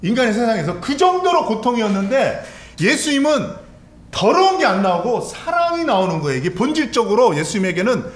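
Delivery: loud, high, impassioned sermon delivery; urgent pulpit cry; fervent preaching. Acted. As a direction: preach the agony at full force. Preacher at full force.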